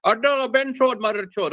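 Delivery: high, exaggerated Indian accent on "Ben chode, mother chode"; sing-song mock Indian accent on "Ben chode". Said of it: "A K freaking got offended".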